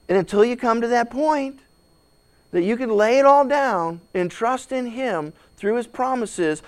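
ordinary delivery

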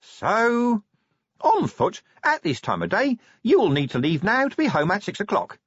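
tiny, high gadget imp. A narrator's reading. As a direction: chirp it like a tiny helpful device. The imp speaking.

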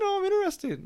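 very high-pitched girlfriend imitation; girlfriend's voice. Host quoting girlfriend hooked on the podcast, high mock voice. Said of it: "She's like".

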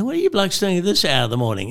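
high, quick retold question; mock indignant challenge. Challenges police in retold roadside scene.

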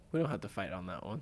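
usual speaking voice

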